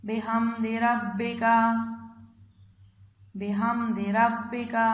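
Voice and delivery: slow, drawn-out tajweed chant, phrase by phrase; Quran recitation. Acted. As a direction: chant each phrase slowly for the child to repeat. Teacher recites Surah Nasr again.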